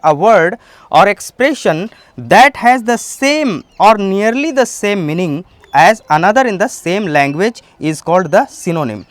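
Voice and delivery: very slow, word by word definition; slow careful classroom dictation; slow dictionary reading. Shown as a demonstration. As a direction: spell out the meaning slowly for students. Reads English definition aloud.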